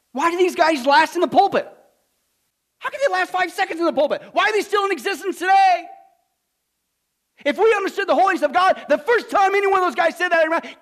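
loud, high indignant preaching; fiery preaching. Acted. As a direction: thunder it from the pulpit. Preaching at full force.

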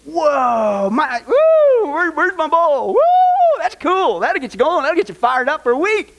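high, loud whoops of amazement; whooping excitement. Pretend. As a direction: hoot and holler like you're fired up. Mock excited reaction to the point.

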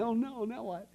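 high-pitched acted dread; whiny "what next" worry; mock dismay. Pastor's dismay as waitress weeps.

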